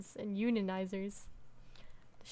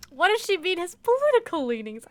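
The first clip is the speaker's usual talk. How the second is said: higher, louder puzzled outburst; baffled by what she said; confused outcry; 6 semitones higher, 7 dB louder than usual. Real, not acted. Streamer reacting to the game's wording, louder and puzzled.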